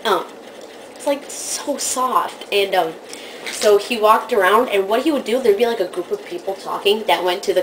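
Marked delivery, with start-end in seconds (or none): none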